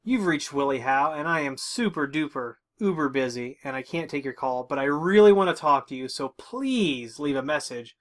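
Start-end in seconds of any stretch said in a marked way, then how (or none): none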